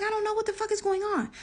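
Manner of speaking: fast, high retold panic; bewildered alarm. Mother retelling confusion before baby's dad, high and hurried. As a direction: rush it out high and baffled.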